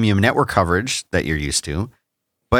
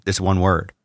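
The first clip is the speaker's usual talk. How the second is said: ordinary talk, nothing marked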